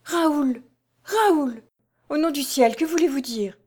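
high, frightened calling of his name; terrified stammer. A narrator's reading. Jeanne cries to Raoul in terror, high. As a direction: gasp it out in terror. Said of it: "balbutia avec une expression de profond effroi".

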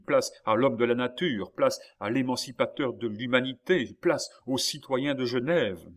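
loud, high heralding call; imperative proclamation. A narrator's reading. Surgeon clearing a way for Rousseau, loudly proclaiming. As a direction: proclaim each title to the crowd. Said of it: "faisant un signe impératif".

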